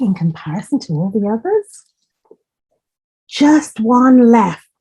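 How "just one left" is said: slow, louder motherly quack of worry; a mother duck fretting over her last egg. A narrator's reading. Reading to a child, Mother Duck counting her eggs, slow and worried. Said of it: "quacked Mother Duck".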